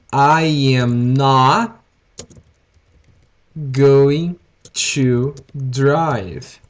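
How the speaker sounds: slow and loud, each word laid out for students; slow model sentence. Shown as a demonstration. Teacher giving students the English, slow and deliberate. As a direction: dictate it slowly so the class can follow. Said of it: "how can I say it in English?".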